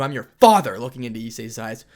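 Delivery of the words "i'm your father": loud indignant claim of fatherhood; angry shout. Acted. Goro reminding his son who he is, loud angry shout. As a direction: bellow it at him. Father asserts authority angrily.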